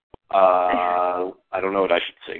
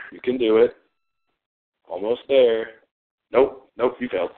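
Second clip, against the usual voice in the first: ordinary talk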